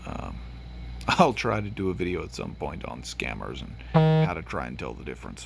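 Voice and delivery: ordinary delivery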